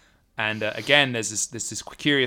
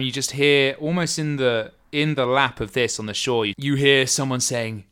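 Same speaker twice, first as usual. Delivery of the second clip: ordinary talk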